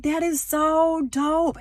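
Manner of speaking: high, loud and slow gush; re-enacted thrilled reaction; excited admiration. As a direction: stretch it out in high-pitched delight. Acting out seeing the ducks.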